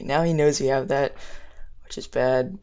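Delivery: usual speaking voice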